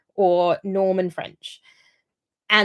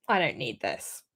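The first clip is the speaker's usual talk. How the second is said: sighed, much softer and lower aside; quiet, world-weary retold reaction; weary exasperation; 8 semitones lower, 8 dB quieter than usual. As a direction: let out a sigh, then say it low and quiet, fed up. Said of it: "and I'm just like".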